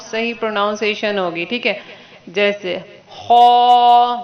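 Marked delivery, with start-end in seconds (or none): 2.3-4.1 خا drawn out heavy and full-mouthed, louder; heavy kha. Shown as a demonstration. Teacher showing the heavy خا. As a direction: fill the mouth on خا slowly. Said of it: "منہ بھر کے پڑھیں".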